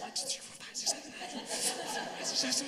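breath-only counting, drawn out; whispered counting. Retold or imitated. Daughter counts out twenty-five on paper, imitated whisper. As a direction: imitate a child whispering through a long count.

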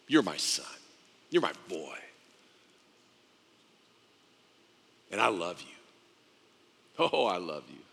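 low, gentle declaration of love; deep fatherly affection, softly voiced; tender father. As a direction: drop low, leaving long pauses.

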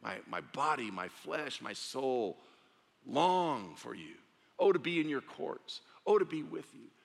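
fervent psalm growing to a cry; rising fervent cry. Acted. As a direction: swell into passionate longing. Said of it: "Goes on to say".

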